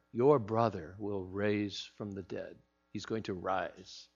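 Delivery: soft, slow and tender; gentle Jesus voice. Acted. Jesus softly reassuring grieving Martha, slow and tender. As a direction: quietly comfort a grieving sister.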